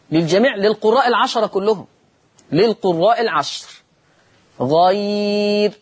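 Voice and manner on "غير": غير drawn out very slowly, ending on a thin r; light ra in pausing. Shown as a demonstration. Teacher shows the stop for all readers, long-held غير. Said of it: "أما وقفا فهي مرققة للجميع".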